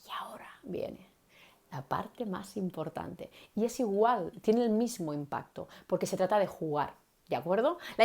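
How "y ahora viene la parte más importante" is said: much softer unvoiced whisper, slow at the end; slow secretive whisper; whispered emphasis. Shown as a demonstration. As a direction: draw them in with a secret whisper. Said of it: "sería interesante decir".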